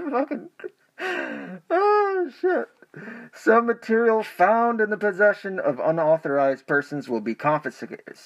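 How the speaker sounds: muffled behind a mask; reading through a face mask; behind welding mask. Real, not acted. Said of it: "reading with my mask on".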